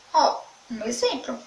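ordinary talk, nothing marked

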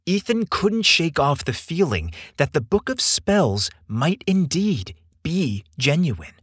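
usual speaking voice

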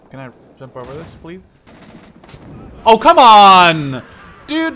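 ordinary talk, nothing marked

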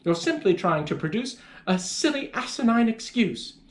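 high-pitched, indignant mother; scolding mother. A narrator's reading. Mother accusing her child of lying, high-pitched scolding. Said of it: "my mother cried".

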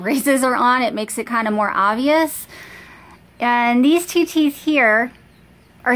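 finger holding lip down; talking with lip pulled down. Real, not acted. Seen 2: fingertip holds lower lip down to show bottom braces while talking.

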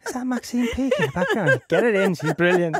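a lightly voiced casting director thrilled; gushing casting director.

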